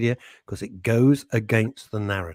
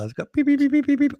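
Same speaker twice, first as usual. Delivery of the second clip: radar beeps; 3 dB louder than usual. Retold or imitated.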